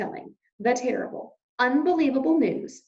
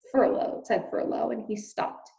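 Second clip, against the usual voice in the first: usual speaking voice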